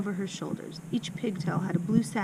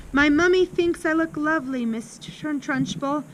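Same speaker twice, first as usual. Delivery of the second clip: put-on higher, stammering child voice; nervous stutter; 6 semitones higher than usual. A narrator's reading. Amanda timidly answers the headmistress, stuttering. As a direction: plead in a high voice, tripping on the name. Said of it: "Amanda stuttered".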